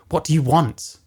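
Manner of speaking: ordinary talk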